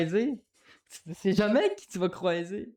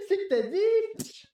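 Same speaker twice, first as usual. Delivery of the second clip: laughing; slower than usual. Real, not acted. Laughing hard at own joke.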